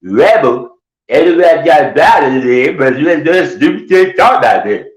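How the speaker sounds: high, fervent caricature; hard-to-follow, high-pitched mock rant; Glenn. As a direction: rant it like an over-dedicated zealot. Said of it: "he gets dedicated. He'll be like".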